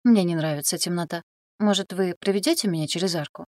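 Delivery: ordinary delivery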